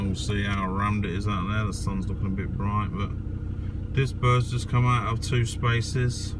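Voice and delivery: in a car park. Real, not acted.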